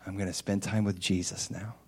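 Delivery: ordinary delivery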